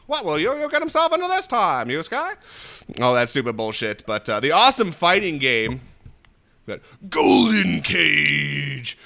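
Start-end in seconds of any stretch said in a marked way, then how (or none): 0.1-2.4 put-on high, odd anime baby voice; weird little baby. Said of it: "weird little baby who talks like this". 7.1-9.0 long drawn-out, loud title call; mock announcer. Announces the game title theatrically.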